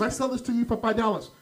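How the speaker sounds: high, loud haggling pitch; pushy vendor. Retold or imitated. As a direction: imitate an insistent street seller. Acted haggling with pushy street vendor.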